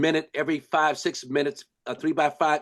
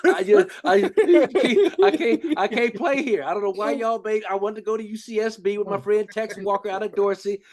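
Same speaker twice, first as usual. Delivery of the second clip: much higher, half-laughing imitation of a teary freshman; mock crying to parents; 7 semitones higher, 3 dB louder than usual. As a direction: whine it up high like a homesick kid, laughing at yourself. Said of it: "crying like a little baby".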